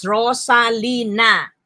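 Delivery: "Rosalina" slowly in four beats, RO-SA-LI-NA; four syllables. Shown as a demonstration. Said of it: "The four syllables".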